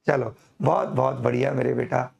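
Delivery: usual speaking voice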